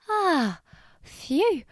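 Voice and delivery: high-pitched sigh of relief; relieved. Acted. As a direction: let the scare go, playacted. Bunny relieved not drowning.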